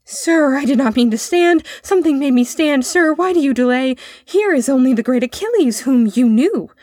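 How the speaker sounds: put-on high, loud, earnest boy; urgent appeal. A narrator's reading. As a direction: call down to urge him up. Boy urges his companion from shield.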